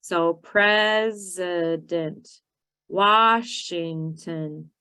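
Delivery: "president" and "Washington" slow, first syllable hit hard; syllable stress demo. Shown as a demonstration. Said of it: "focusing on the syllable stress".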